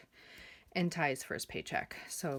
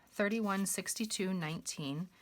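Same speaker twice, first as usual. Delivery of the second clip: usual speaking voice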